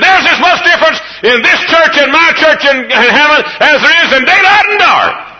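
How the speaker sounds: high, loud preaching cry through laughter; preaching quote. Retold or imitated. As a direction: belt it like the pulpit line it was. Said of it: "like I said at that colored church where we were preaching".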